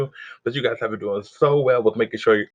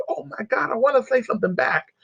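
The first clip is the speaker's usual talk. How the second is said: excited; 10 semitones higher than usual. Retold or imitated. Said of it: "and I was like".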